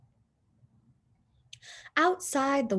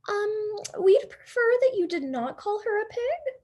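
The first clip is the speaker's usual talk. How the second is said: prim high-pitched Missus Watson; lilting, higher voice for a proper lady; high sweet lady voice; 13 semitones higher, 3 dB louder than usual. Acted.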